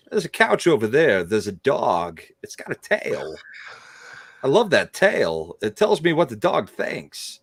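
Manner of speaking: halting celebrity impression with swooping pitch; odd surges of pitch mid-phrase, dropping at the ends; Christopher Walken. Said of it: "you got the right tone".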